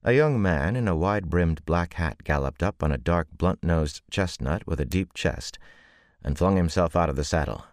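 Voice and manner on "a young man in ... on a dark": ordinary delivery, nothing marked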